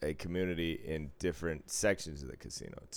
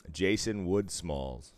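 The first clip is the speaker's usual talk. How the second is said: ordinary delivery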